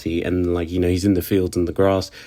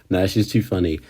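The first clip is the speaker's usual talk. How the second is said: ordinary talk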